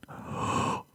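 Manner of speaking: sharp sudden intake of breath; shocked gasp. Acted. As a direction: gasp as if seeing something appalling. Rarity sees the jacket, shocked.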